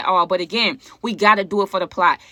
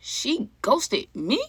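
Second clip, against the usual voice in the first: hurt disbelief; 3 semitones higher, 6 dB quieter, much slower than usual. Real, not acted. Stunned at being ghosted.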